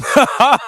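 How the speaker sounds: a loud, high laugh at himself; burst of laughter. Real, not acted. Host laughing loudly at his own failed accent. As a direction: break into a real laugh.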